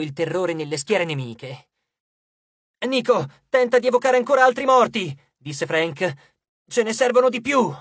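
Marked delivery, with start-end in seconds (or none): 2.9-5.2 loud, high battle command; urgent order. A narrator's reading. Frank asking Nico to summon more dead, urgent shout. 6.7-7.7 higher, raised battle voice; urgent call. A narrator's reading. Frank to Nico, needing reinforcements, urgent raised voice.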